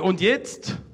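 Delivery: loud call into the next room; shouted question. Retold or imitated. Guest calling again to the living room, shouting. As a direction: throw the question over the wall.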